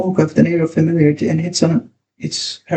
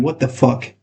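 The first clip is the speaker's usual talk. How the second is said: ordinary delivery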